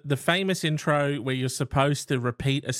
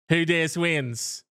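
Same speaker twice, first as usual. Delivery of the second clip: imitating Michael Whitney; 3 semitones higher, much slower than usual. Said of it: "Michael Whitney saying at the end".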